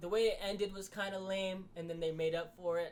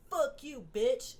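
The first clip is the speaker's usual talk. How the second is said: yelled insult, pitched up and louder; a mocking shouted insult; 8 semitones higher, 7 dB louder, much slower than usual. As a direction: bark it like a lazy heel. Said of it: "without needing to be like".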